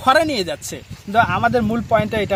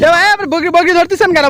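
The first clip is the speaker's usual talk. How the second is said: high, loud shouted question; urgent calling out; 8 semitones higher, 9 dB louder than usual. Real, not acted. Reporter asking why he is catching the goat, urgent shout. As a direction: shout to get his attention.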